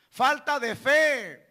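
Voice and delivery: ordinary talk, nothing marked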